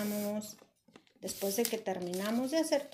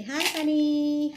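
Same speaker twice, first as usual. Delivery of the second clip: puppet voice; 4 semitones higher, 3 dB louder, slower than usual. Acted. Teacher voicing puppet bunny.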